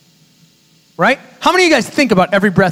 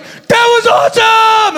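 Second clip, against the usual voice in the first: ecstatic shout; 12 semitones higher, much slower than usual. Retold or imitated. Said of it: "he is like".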